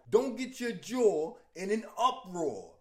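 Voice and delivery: slow, smug mobster quip; wise guy attitude. Acted. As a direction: drawl it like a cocky gangster. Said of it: "showing a wise guy attitude".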